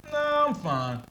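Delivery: a polite but final no, voice jumping up; high firm brush-off. Retold or imitated. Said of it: "I don't know why my tone goes there".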